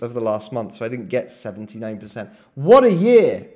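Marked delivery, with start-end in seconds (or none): 2.5-3.5 loud, high exclamation of admiration; real delight at the yearly gain; impressed. Real, not acted. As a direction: burst out loudly, impressed. Marvels at trader's yearly return.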